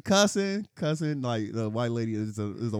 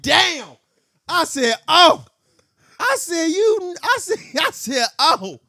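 much higher, louder stunned cries; gasping, squeaky disbelief; mock shock; 8 dB louder, slower than usual. Retelling his reaction to a friend, high loud shocked squeals.

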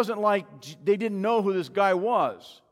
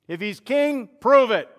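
loud, defiant challenge; demanding challenge; 3 semitones higher, 8 dB louder than usual. Acted. Doubters speaking about the king, loud demanding challenge. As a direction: throw it down as a dare, loudest on the last words.

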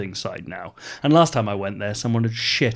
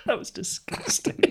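amused disgust; 13 semitones higher, 7 dB quieter than usual. Real, not acted. Reacts to the passage just read.

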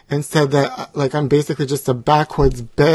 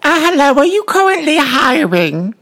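higher, louder put-on voice; high-voiced job seeker; 9 semitones higher, 5 dB louder than usual. Ex-talk-show guest asking a fast food worker for a job, high put-on voice.

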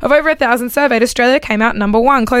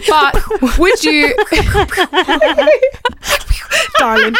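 laughing mock Irish; 8 semitones higher, much slower than usual. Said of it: "Hi, darling".

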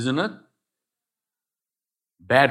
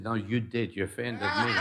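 objecting listener; 4 semitones lower, 8 dB quieter than usual. Retold or imitated. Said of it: "someone listening and saying".

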